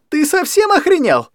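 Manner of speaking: very high, loud yell. Acted. Anya yelling at her drunk guardian. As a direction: scream it in his face. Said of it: "орала Аня на всю комнату".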